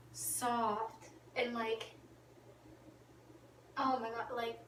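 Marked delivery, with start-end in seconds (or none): none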